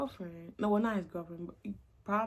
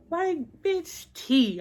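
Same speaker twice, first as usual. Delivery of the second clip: excited gossip; 9 semitones higher, 9 dB louder, much slower than usual. Real, not acted.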